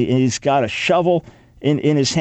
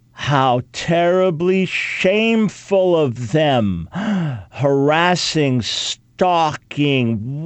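sarcastic gasping horror, slowed and rising; mock outrage; much slower than usual. Host mocking critics of the video, sarcastic gasps. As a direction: ham up the shock with gasps.

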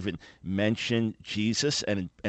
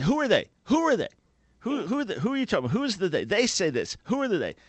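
high-pitched, insistent cross-examining dad; father's indignant questioning; 10 semitones higher, faster than usual. Retold or imitated. Senior lawyer dad challenging his child's vague "they", indignant questioning. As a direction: pepper them with high, incredulous questions. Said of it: "and he'd say".

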